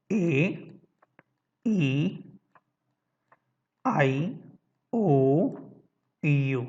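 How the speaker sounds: اے ای آئی او یو said one by one with long pauses; vowels recited slowly. Shown as a demonstration. Teacher spelling vowels to students slowly. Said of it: "وہ کون کون سے ہیں؟".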